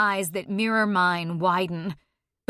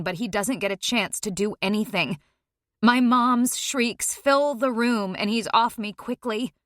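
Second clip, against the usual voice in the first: plain reading voice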